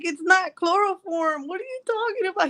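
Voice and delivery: high-pitched mock indignation; defensive protest. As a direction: protest in a squeaky, incredulous pitch. Retells defensive protest to husband.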